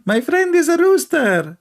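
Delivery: squeaky, mocking uncle voice; high mock uncle voice. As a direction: pitch it up high like a naive old uncle answering. Mocking retold dialogue with uncle.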